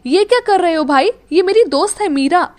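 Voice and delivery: shocked at her brother; alarmed scolding. Acted. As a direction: break them apart in alarm. Nidhi separates them.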